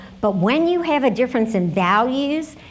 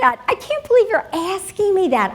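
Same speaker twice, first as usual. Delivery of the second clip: higher-pitched, affronted protest; mock-scandalized, pitched up; acted disbelief; 8 semitones higher than usual. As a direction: imitate a friend shocked by a rude question. Friend shocked by rude question.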